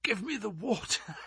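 faint breathless plea; gasping. A narrator's reading. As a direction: gasp it out, parched and weak. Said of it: "he gasped".

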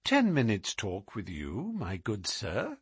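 disarm an angry man with sweetness; hushed, silky courteous request; sweetest of voices. A narrator's reading. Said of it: "in the sweetest of voices".

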